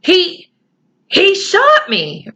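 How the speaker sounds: shocked. Acted. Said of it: "Patrick got out again in shock".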